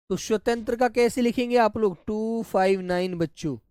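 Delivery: ordinary delivery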